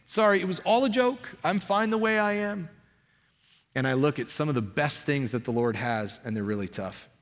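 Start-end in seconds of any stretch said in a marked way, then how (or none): 0.0-2.7 high, whiny mock backpedal; backpedaling excuse. Preacher mocks himself quitting on God, high sheepish backpedal. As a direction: pitch it up like a sheepish quitter taking it all back.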